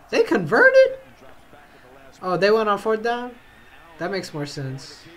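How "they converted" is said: high-pitched squeak of disbelief; astonished. Real, not acted. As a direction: squeal it as a stunned question. Surprised by a converted play.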